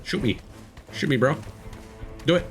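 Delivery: usual speaking voice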